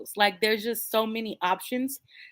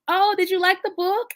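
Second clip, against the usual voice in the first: much higher, chirpy polite question; imitating the lady; 10 semitones higher, 4 dB louder than usual. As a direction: mimic a sweet nosy stranger. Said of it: "the lady behind me was like".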